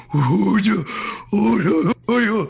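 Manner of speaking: put-on loud high cooing baby talk; mock baby talk. Mocking baby talk at an old dog, loud and cooing. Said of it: "walk up to a seventy-year-old person and go".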